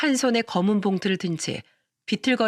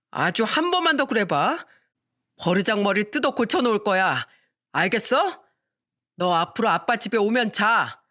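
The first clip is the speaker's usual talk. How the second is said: put-on drunk father threat.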